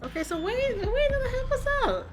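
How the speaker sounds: loud excited outburst. Real, not acted. Player excited, laughing.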